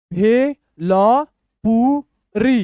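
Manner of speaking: slow, widely separated syllables. Shown as a demonstration. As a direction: sound out each syllable for learners. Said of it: "तोड़ तोड़ के पढ़िए".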